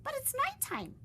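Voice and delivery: very high squeak; high squeaky worry. Acted. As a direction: protest in a tiny voice. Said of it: "Stellaluna squeaked".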